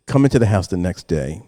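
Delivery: normal talking voice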